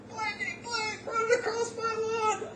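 hammed-up grumpy neighbor shouting; cursing neighbor. Man in lawn chair curses the boy crossing his lawn, mock angry yell. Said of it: "he curses at me".

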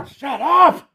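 high, loud irritated outburst; angry snap. Retold or imitated. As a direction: bite back at the heckler. Prophets snap back at Elijah.

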